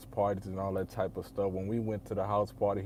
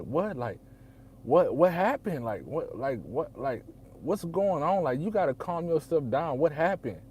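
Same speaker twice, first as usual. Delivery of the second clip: alarmed; 6 semitones higher, 4 dB louder than usual. Retold or imitated. Sister calls crying with news.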